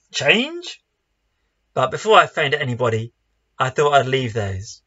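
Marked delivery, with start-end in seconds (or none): 0.1-0.8 high, rising mock gasp; a scandalised churchgoer recoiling; mock horrified church member. Joke's stuffy congregant, high indignant question. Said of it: "typical answer is".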